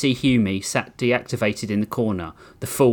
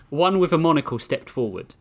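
ordinary talk

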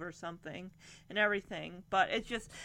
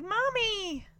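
high-pitched imitation of a little girl calling out; mock excited child, voice jumping high; imitated child's cry; 11 semitones higher than usual. Retelling the girl running to her mom, imitated child's cry.

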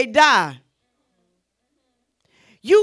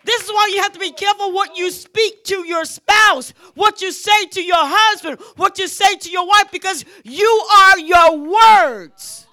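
preaching; 4 semitones higher, 6 dB louder than usual. Acted. Preaching about spouses.

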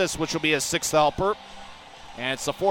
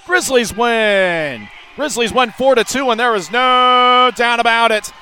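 victory call shout; 6 semitones higher, 8 dB louder than usual. Real, not acted. Final horn call.

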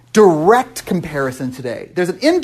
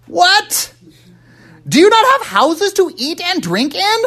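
incredulous outburst; 12 semitones higher, slower than usual. Acted. Indignant rhetorical question in reading.

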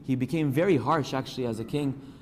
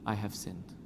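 much softer, humbled admission; quiet confession; 3 semitones lower, 7 dB quieter than usual. Retold or imitated. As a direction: let it drop to almost nothing. David confesses.

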